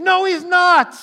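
high, loud defiant shout; loud retort. Retold or imitated. Preacher mimicking a heckler shouting back at the singing. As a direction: shout the denial back hard.

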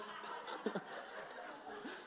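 airy, voiceless burst of laughter; real, silent wheezing laugh; breathless laugh. Real, not acted. Laughs at own blunder.

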